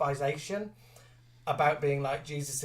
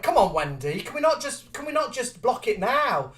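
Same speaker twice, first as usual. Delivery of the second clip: higher, louder pleading; pleading friend; 8 semitones higher, 6 dB louder than usual. Retold or imitated. Friend begging the director to stage the scene now. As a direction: nag the director to block it now. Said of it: "she went".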